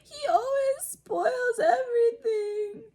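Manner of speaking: very high, loud, slow mock wail; hammed-up pretend crying tantrum; fake-sob yell. A narrator's reading. Dudley fake-crying to his mother, loud hammed-up wailing. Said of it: "huge pretend sobs".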